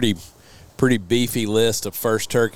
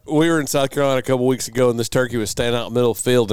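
ordinary delivery

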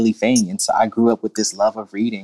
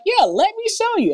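high, eager yes; excited child voice. Retold or imitated. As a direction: squeal it like a thrilled kid. Voicing excited kid.

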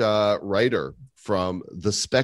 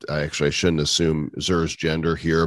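ordinary delivery

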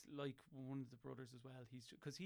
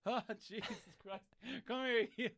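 high, loud gushing cry; gushing at dog; 8 semitones higher, 8 dB louder than usual. Retold or imitated. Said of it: "And I'll be like".

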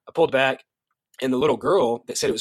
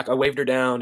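plain reading voice

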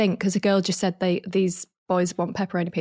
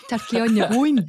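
laughing, broad Scottish mum; Scottish accent; 3 semitones higher, 4 dB louder than usual. Retold or imitated. Daughter mimics mum's order, laughing, Scottish accent. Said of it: "obviously my mum's Scottish".

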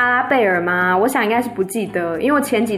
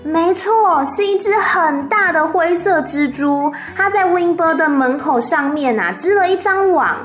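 put-on high, bright-eyed little girl voice; little Fern chattering to her parents, high and eager; 5 semitones higher than usual. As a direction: tell it like an eager child reporting news. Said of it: "芬就点点头说".

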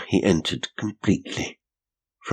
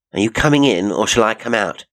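louder, firm challenge through the door; bold tactics; 5 semitones higher, 6 dB louder, faster than usual. A narrator's reading. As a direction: call his bluff boldly. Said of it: "adopting bold tactics".

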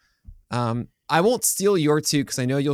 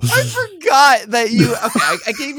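laughing; 8 semitones higher, 5 dB louder than usual. Real, not acted. Laughing while excusing himself.

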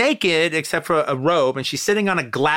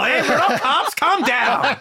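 put-on higher, laughing girlish voice; 8 semitones higher, slower than usual. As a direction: mimic the friend, pitched up and giggling. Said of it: "you sounded like the girl".

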